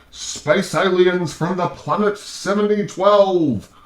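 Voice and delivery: high, slow, wacky mock voice; kooky conspiracy voice. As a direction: ham up the alien believer. Mocking a crazy alien claim.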